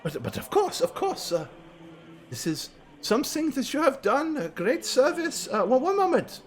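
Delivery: high, soft, fawning cleric voice; high priest voice. Acted.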